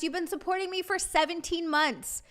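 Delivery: normal talking voice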